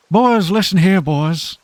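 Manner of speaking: put-on calling the boys over in Newfoundland style; Newfie accent. Streamer attempts a Newfie voice, loud hammed-up Newfoundland accent. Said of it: "it's not happening".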